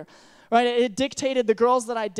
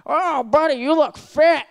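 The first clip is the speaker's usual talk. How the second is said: put-on loud, higher old Australian coach calling out; Australian accent; 4 semitones higher, 7 dB louder, slower than usual. Old Australian coach yelling across the track in Australian accent. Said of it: "pretty spot on to his accent".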